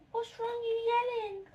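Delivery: high-pitched cooing to a cat; sing-song question to a beloved cat; pet-talk voice. Real, not acted. Talking to her cat.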